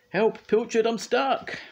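very high, loud cry for help; squealing. A narrator's reading. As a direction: squeal in hammed-up panic. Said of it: "squealed Scoop".

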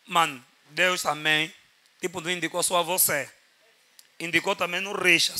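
ordinary talk

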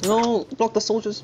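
loud, high yell of protest; frantic shout. Real, not acted. Player ordering a block of the soldiers mid-fight, frantic shout.

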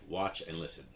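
ordinary talk

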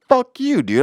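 a high, loud furious burst; angry outburst. Real, not acted. Fed-up customer cursing the grocery store, high and loud. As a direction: explode at the store.

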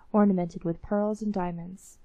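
usual speaking voice

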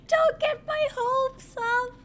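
slow, high words broken by laughter; laughing hard. Real, not acted. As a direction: squeal it through helpless laughter. Laughing at chat's prediction.

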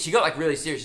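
ordinary talk, nothing marked

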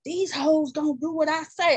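imitating a bossy pimp; cocky pimp voice, lightly done; smooth flashy pimp swagger. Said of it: "she be talking like a pimp".

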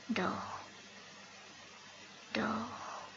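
soft, fading "sleep"; hushed lullaby. Acted. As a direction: say it very softly, letting each word die away. Poem's lullaby to dead child. (berceuse murmurée tout bas)

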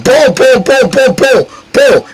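high, repeated impact noises; imitated headbutt booms. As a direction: voice every headbutt as a boom.

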